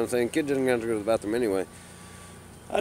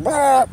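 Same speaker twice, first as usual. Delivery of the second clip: a high, loud burst imitating her lunging at him; mock aggressive shout "bah"; 12 semitones higher, 8 dB louder than usual. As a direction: bark it out like someone jumping in your face. She jumped in my face.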